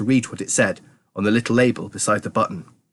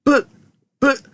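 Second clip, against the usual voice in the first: high, stammering protest; stammer, then cry out in alarm; high-pitched spluttering panic; panicked shout; 13 semitones higher, 3 dB louder than usual. A narrator's reading. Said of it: "shouted Grandpa Joe".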